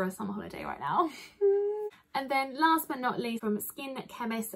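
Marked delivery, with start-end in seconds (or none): none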